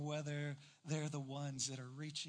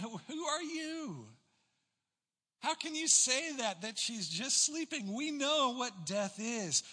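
higher, louder scoffing crowd voice, first words slow; mocking mourners; 8 semitones higher, 6 dB louder than usual. Mourners mocking Jesus at house.

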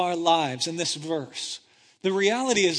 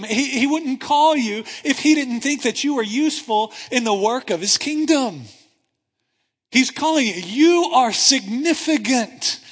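louder, rising preaching; preaching at full force; 3 semitones higher, 5 dB louder than usual. Acted. Preacher telling the congregation they matter, full-force preaching. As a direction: lift them up, you are significant.

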